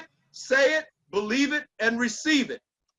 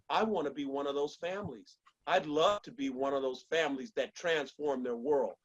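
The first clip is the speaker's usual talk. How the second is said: hushed inner thought; 8 semitones lower, 7 dB quieter than usual. Retold or imitated. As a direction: lower and much softer, as if thinking privately. People's imagined inner thoughts.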